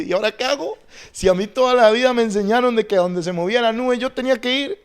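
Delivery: high, agitated mock protest; acted bewilderment. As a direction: plead confusion as a thrown-off Joshua. Acting out a bewildered Joshua.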